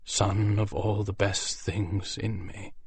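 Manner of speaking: lingering tender hush; very softly. Acted. Dying father blessing his griffin son, slow and soft. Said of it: "he said, very softly".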